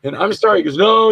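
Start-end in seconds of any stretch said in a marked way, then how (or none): 0.0-0.6 higher-pitched reenacted apology; pleading apology. Scolded actor apologises to director. 0.8-1.1 exasperated reassurance. Retold or imitated. Director relents, still worked up.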